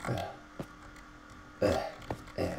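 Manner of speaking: short annoyed groans; frustrated groan. Real, not acted. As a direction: groan in disgust.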